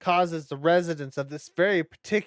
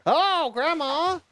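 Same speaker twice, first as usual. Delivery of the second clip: high, slow startled cry; 12 semitones higher, 5 dB louder, slower than usual. Real, not acted. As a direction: jump at the sight of her. Startled by grandma.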